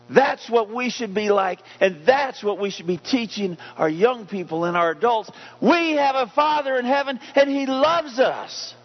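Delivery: preacher shouting the good news to his church; preaching at full force. Acted. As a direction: lift it up to the rafters. Preacher building to a climax.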